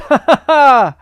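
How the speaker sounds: big, loud hearty laugh, high; splendid laugh. Acted. Scrooge's first laugh in years, loud. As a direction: laugh out with glee. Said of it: "it was a splendid laugh".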